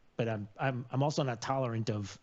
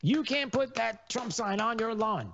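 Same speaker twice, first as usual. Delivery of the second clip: mock scold; 10 semitones higher than usual. Mocking someone scolding a neighbour.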